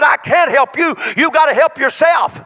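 loud, high stern shout; God's stern reply. Acted. God answering Joshua, a loud stern shout. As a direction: rebuke him with full force. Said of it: "And God said".

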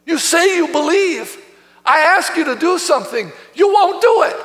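exasperated, pitch climbing to the end; frustrated Savior. Acted. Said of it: "Can you hear the frustration".

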